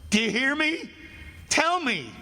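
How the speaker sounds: high-pitched, urgent pleading; desperate demand. Acted. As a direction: demand an answer as if your life hangs on it. Condemned man demands the killer's name.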